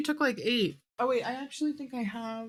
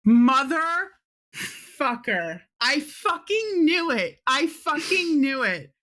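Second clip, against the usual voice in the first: loud, high, exasperated swearing; raised-voice exasperated cursing; exasperated outburst; 6 semitones higher, 6 dB louder than usual. Real, not acted. Player curses at what game master narrates.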